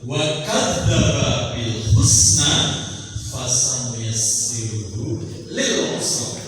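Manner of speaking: slow melodic tilawah; Quranic recitation. Acted. Preacher reciting Surah al-Lail to the audience, slow melodic chant. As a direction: stretch the vowels, chant the verse.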